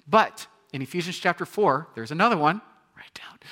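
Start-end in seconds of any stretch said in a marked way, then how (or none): 2.9-3.4 a hushed instruction in passing; whispered aside. Real, not acted. Pastor whispers to the congregation, take notes. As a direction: whisper it quickly.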